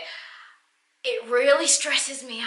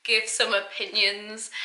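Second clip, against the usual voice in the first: usual speaking voice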